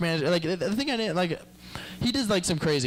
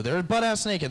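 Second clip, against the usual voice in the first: ordinary talk, nothing marked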